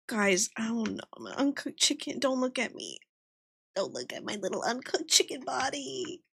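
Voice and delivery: small, soft embarrassed plea; mock-shy murmur. Mock-shy plea to not look.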